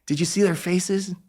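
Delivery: put-on a touch of a gentle old man in awe; Stan Lee voice. Old man asking a young fan about happy faces, gently amazed. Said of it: "And he's like".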